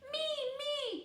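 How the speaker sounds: put-on high, eager child calling me me. A narrator's reading. Chelsea begging the teacher to let her stand, eager. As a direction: call out to be picked next. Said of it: "called Chelsea".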